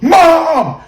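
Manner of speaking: high, loud wail at mom; drawn-out, tearful mock wail; hysterical. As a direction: act out your own hysterical disbelief. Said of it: "I was hysterical".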